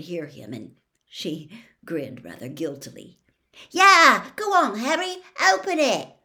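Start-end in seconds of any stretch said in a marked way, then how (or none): 3.7-6.1 high, loud eager chorus; eager urging. A narrator's reading. Classmates urging Harry to open the egg, eager. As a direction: egg him on keenly. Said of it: "several people echoed".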